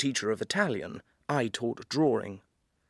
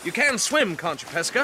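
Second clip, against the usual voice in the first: hearty bravado; 6 semitones higher, 4 dB louder than usual. Acted. Bracing himself before cold dip.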